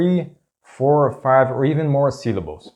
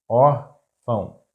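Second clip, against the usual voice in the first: órfão slowly split, ór - fão; syllable by syllable; slower than usual. Shown as a demonstration. Teacher breaking down an example word, órfão slow in syllables.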